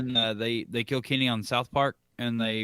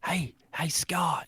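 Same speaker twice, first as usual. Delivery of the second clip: whispered call; 3 semitones higher, slower than usual. Real, not acted. Whispering as show goes live.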